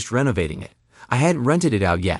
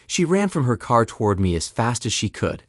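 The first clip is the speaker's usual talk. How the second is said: ordinary delivery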